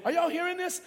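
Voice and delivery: usual speaking voice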